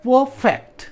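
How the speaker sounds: Perfect with a strong फेक्ट; stress on second syllable. Shown as a demonstration. Teacher asking students where stress falls, second syllable stressed. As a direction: lean hard on फेक्ट in perfect, high then falling. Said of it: "फैक्ट पे".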